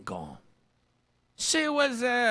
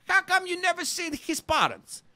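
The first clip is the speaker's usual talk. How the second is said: ordinary delivery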